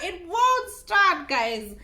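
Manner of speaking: slow, high, loud wail; dramatic despair. Real, not acted. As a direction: drag it out in mock despair. Dramatic stress about the car.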